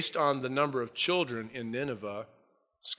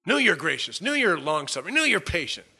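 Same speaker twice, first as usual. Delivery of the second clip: louder, higher, sour emphasis on each knew; bitter complaint; 5 semitones higher, 4 dB louder than usual. Retold or imitated. Jonah grumbling to God about his mercy, bitter and louder. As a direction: spit out every knew like an accusation.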